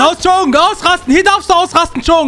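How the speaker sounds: high, excited shouting; urgent battle cry. Acted. Babysitter unleashing boy on gunmen, high urgent shout. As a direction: egg him on at the top of the voice.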